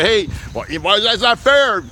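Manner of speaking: high, drawn-out protest; customer protesting. Acted. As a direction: whine in mock complaint like a cheated buyer. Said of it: "sometimes you say".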